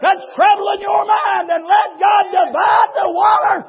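fiery pulpit shouting; full-force preaching. Real, not acted.

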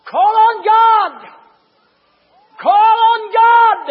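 very loud, high, drawn-out shout; father's frantic cry, acted full out; desperate holler. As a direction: holler it to someone trapped. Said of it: "her daddy turned around and hollered".

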